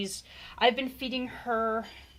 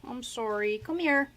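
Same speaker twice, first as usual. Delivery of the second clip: usual speaking voice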